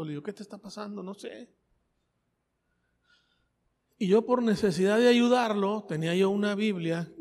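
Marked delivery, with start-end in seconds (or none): 0.2-1.5 hushed exchange. Retold or imitated. Retold talk with trembling friend.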